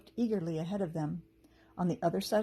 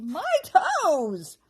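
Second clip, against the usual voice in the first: high, loud goblin wail; pained goblins; 9 semitones higher, 9 dB louder than usual. A narrator's reading. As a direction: wail about sore toes. Goblins hurt by hedgehog.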